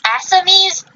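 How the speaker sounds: "Assamese" offered as a careful attempt; tentative pronunciation. Real, not acted. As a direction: try "Assamese" as AS-uh-meez, unsure. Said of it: "forgive me if I mispronounce this".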